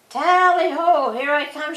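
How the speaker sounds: hallo it out gleefully; hunting shout. A narrator's reading. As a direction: Claude shouts ahead to his brothers. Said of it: "shouted Claude Pole".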